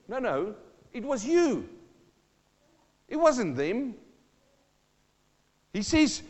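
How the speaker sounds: usual speaking voice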